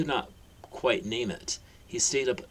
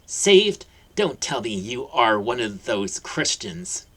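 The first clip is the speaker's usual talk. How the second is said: scornful mockery; 3 semitones higher, 7 dB louder, slower than usual. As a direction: scoff at the very idea. Aunt Petunia mocks religion.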